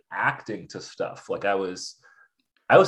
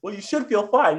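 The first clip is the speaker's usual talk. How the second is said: much higher, patronizing tone; mocking logic; 12 semitones higher than usual. Imitating friends who lecture her, high and patronizing. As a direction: sound smugly reasonable.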